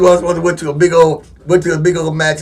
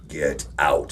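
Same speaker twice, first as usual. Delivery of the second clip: scary movie whisper; 12 dB quieter than usual. Retold or imitated.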